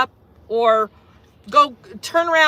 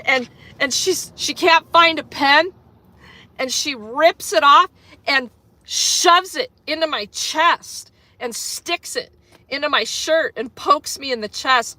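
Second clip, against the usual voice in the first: normal talking voice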